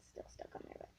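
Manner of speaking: soft unvoiced whisper; whispered aside. Real, not acted. Girl fiddling with packaging, whispers to herself. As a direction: whisper it to yourself.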